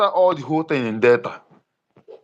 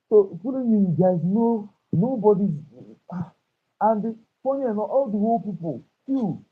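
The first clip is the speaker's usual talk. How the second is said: muffled and much softer; 4 semitones higher, 8 dB quieter than usual. Real, not acted. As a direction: speak as if through a hand over the mouth. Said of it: "your voice is getting muffled".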